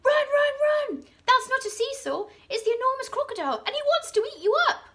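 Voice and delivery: very high, loud alarm; monkey yelling a warning to the children; shouted run. A narrator's reading. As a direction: scream at them to run. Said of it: "Mugglewump shouted".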